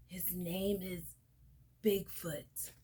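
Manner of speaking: whispered reveal of the name; spooky whisper. A narrator's reading. Storyteller to children naming the giant, spooky whisper. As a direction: breathe the name like a secret.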